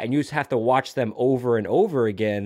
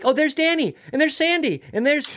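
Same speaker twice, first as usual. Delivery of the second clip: much higher, sweet imitation of the waitress; 13 semitones higher than usual. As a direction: pitch it way up like a doting diner waitress. Waitress voiced naming the kids.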